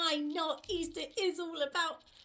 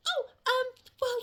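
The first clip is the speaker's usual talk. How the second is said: usual speaking voice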